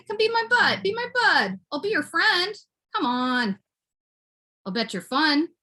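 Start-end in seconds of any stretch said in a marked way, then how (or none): 0.1-3.6 high-pitched wheedling plea; high coaxing. Pretend. Begging the little guy to be friends, high coaxing. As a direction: coax him in a high voice.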